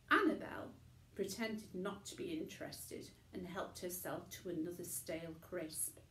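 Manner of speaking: ordinary delivery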